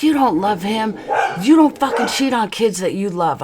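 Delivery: high, indignant rebuke; retold accusation. Wife rebuking husband beside their child, indignant.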